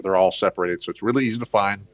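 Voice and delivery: ordinary talk, nothing marked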